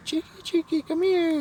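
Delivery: squeaky-high falsetto calling a dog; mock yelling woman. Lady calling her runaway dog, mock high-pitched yell. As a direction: imitate a woman's very high, piercing dog call. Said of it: "yelling at her dog".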